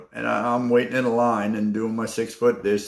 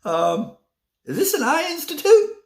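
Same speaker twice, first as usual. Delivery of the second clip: mock-polite, much higher question; pointed question; 3 dB louder than usual. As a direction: ask it with a dig of disbelief. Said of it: "I said".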